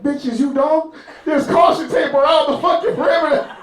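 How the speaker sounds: high-pitched, shouted alarm at the obvious; exasperated yelling. Acted. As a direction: yell it in comic panicked disbelief. Acts out friend yelling at girls.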